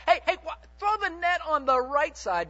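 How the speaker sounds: calling out. Retold or imitated. Jesus calling from the shore.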